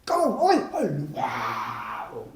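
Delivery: sudden shouted outburst; hammed-up attention-grabbing yell; distracting passenger. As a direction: call out like a pestering passenger. Passenger bursts out once more.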